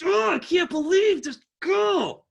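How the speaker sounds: high, loud, slow outraged shout; mad kid. Retold or imitated. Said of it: "let's say your kid is mad".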